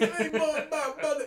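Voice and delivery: loud, high cartoon jock; idiot jock. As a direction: blurt it like a show-off meathead. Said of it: "He sounded like such an idiot".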